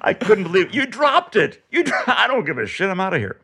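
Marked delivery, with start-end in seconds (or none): 0.7-1.6 high-pitched mock accusing yell; imitated shouting. Dentist shouting at a woman in back, high mock yell. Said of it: "the dentist who was shouting at her". 1.7-3.4 high mock fury breaking into laughter; imitated outburst. Retelling a dentist's office shouting match, high and laughing.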